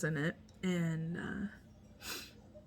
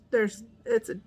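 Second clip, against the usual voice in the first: normal talking voice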